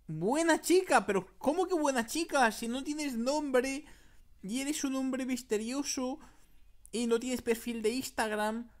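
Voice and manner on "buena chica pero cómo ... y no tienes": high, loud mock outrage; indignant disbelief. Gamer answering the mystery man's message, indignant disbelief. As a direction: throw the words back, incredulous.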